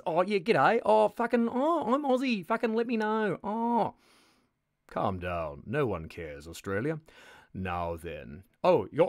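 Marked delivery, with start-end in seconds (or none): 0.0-3.9 higher, louder mock Aussie rant; mock Australian accent. Mocking Australian viewers in a loud, high fake Aussie accent. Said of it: "Fucking Australians".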